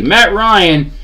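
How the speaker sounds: usual speaking voice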